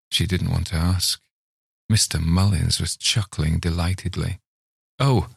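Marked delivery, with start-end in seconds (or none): 5.0-5.3 startled cry. Acted. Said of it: "Melanie cried".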